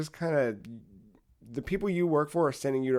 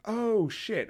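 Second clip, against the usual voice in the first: mock surprise; 8 semitones higher than usual. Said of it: "and you're like".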